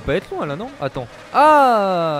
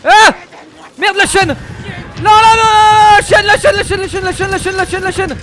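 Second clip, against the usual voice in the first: frantic high screaming; panicking gamer; 9 dB louder than usual. Real, not acted. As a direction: yell in terror, very high and loud. Chain fails during chase.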